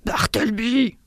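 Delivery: hoarse, whispery mock shout; strained, breath-heavy yell at no great volume; vociferating. A narrator's reading. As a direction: vociferate it with the volume held back. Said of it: "vociférai-je".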